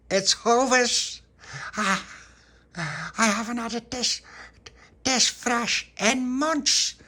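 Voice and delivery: high, loud, stretched-out delight; excited exclaiming. Acted. Tony's jam treat being greeted, loud excitement. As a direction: crow over it slowly and loudly.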